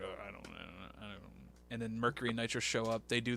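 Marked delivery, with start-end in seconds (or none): 0.0-1.2 very soft, low mumble; retold mumbling. Podcaster retelling Vince's answer about special treatment, low mumbled evasion. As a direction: imitate someone fumbling under his breath. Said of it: "And he's like".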